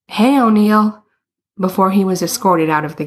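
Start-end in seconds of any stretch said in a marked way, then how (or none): 0.1-1.0 restrained narrated yell. A narrator's reading. Player calling to O'Neill on the bench, mild yell. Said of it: "waved, and yelled".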